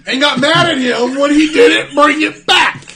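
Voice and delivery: high, loud, laughing holler; mock outrage. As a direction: shout it slowly in gleeful disbelief. Mock outrage retelling her anger.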